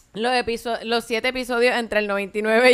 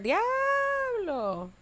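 high, softer long-held cry; drawn-out exclamation; 11 semitones higher, 6 dB quieter than usual. Real, not acted. Host reacting to past scandals, amazed.

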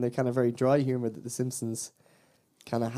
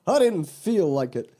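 slow, higher, louder put-on retort; quoted cartoon guy; 6 semitones higher, 5 dB louder, slower than usual. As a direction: imitate him, loud and drawn out. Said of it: "and the guy's like".

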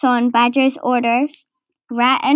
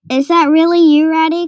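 raised, louder hopeful call; 5 semitones higher, 6 dB louder than usual. A narrator's reading. Mole calls to Rat from the hollow tree, calling out. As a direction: call back from a hiding place. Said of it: "called Mole from the hollow".

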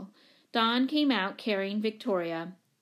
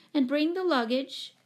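higher-pitched call down a corridor; 6 semitones higher than usual. A narrator's reading. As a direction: call out the instruction. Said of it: "called Mother from the doorway".

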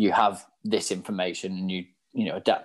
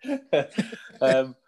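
laughing; 5 semitones higher, slower than usual. Real, not acted. Teased about having had hair.

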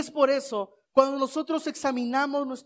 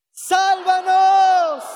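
long drawn-out shout, higher and louder; 6 semitones higher, 7 dB louder, much slower than usual. Shown as a demonstration. Preacher leads the church shouting for salvation, loud drawn-out cry. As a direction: cry out for salvation with full force.

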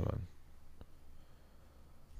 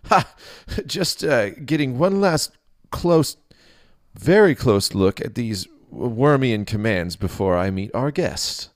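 put-on nervous young priest; 7 semitones higher, 7 dB louder than usual. Startled priest covers to king.

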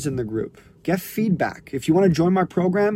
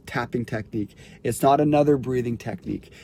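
normal talking voice

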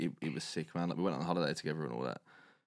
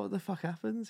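much higher, an imitated stunned cry; shocked question; 14 semitones higher than usual. Housemates asking the sheepish lad what happened: stunned. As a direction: voice the housemates' disbelief at the slammed door.

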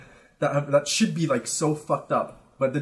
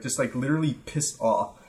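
ordinary talk

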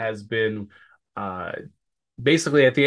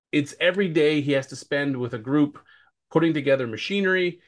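usual speaking voice

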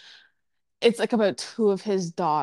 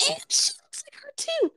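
whispering. Real, not acted. Aside while realising resemblance.

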